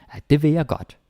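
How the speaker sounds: vil said short and slurred in ah det vil jeg godt; casual contracted vil. Shown as a demonstration. Teacher showing learners how Danes really say vil. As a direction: toss it off casually, high and loose. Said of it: "We just like to say vil".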